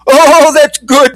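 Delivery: high and slow, savouring it; drawn-out old fly pleased as he spins; delighted old-timer. Acted. Old-timer spinning on his back, high and slow. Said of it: "the old-timer said".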